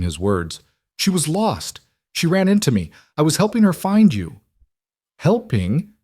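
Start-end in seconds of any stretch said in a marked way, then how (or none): 1.0-2.9 higher panicked explaining. A narrator's reading. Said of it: "Noah's voice came out louder". 3.2-4.4 high, loud panicked plea; raised voice, panic driving the words. A narrator's reading. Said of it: "louder than he meant, panic sharpening". 5.2-5.9 brittle scorn. A narrator's reading. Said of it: "The woman's laugh was brittle".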